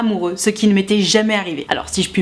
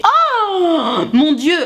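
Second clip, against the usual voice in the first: higher, very slow, drawn-out oh mon Dieu; dismayed; 7 semitones higher, much slower than usual. Real, not acted. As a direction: stretch out the dismay. Dismayed at the girl's behaviour.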